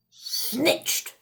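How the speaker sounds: whispered, grumbling aside; muttered. A narrator's reading. Old man mutters a whispered accusation at the wizard. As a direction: grumble it under the breath. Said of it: "muttered the old man".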